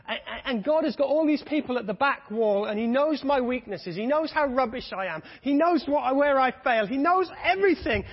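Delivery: building sermon fervour. Acted. Preacher telling the church God picks the weak, loud and high. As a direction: swell into it, full voice.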